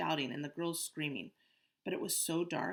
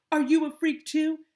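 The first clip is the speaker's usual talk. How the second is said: high, loud mimic of bully; mock the bully's sneer; 12 semitones higher, 15 dB louder than usual. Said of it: "He was like".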